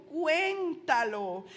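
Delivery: CUÉNTALO stretched out long and emphatic; drawn-out exhortation. Real, not acted. Preacher urging the congregation to testify, "cuéntalo" in a drawn-out exhortation.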